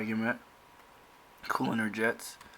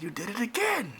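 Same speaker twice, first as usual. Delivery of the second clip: high-pitched accusing outburst; acted sister going off; 12 semitones higher than usual. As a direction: let the pitch soar in exasperation. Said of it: "her sister going off again".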